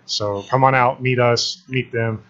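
normal talking voice

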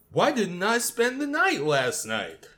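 high-pitched, drawn-out clueless question; slow, high mock bewilderment; clueless Andy. Podcaster voicing Andy's passive question about last night, high mock-clueless. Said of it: "a relationship by Andy going".

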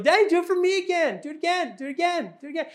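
put-on very high, pleading child chant; a little girl chanting at her dad for another spin; little daughter's voice. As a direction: beg over and over like a small girl. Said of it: "And the second one".

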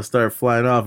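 ordinary talk

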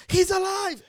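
high, loud joyful cry; elated yell. Retold or imitated. Waking the room with news the friend is alive. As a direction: burst out with relief. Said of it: "I'm like".

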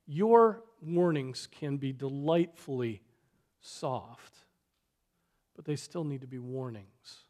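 usual speaking voice

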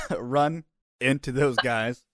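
usual speaking voice

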